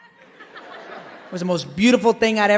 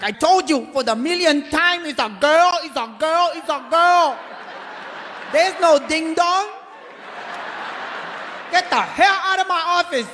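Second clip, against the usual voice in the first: Korean doctor; 8 semitones higher than usual. Retold or imitated. Korean doctor snapping at the father-to-be, mock Korean accent. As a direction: bark it like a fed-up Korean doctor. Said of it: "He's like".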